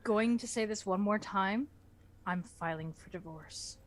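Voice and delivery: usual speaking voice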